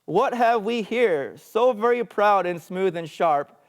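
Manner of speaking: loud, high, declaimed wonder; crying out. Acted. Second blind man exclaiming over the tusk, crying out. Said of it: "cried, 'Ho".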